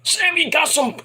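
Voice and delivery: high, strained cheering; hammed-up jubilant yell; mock crowd cheer. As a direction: imitate a whole crowd going wild. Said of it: "everybody's like".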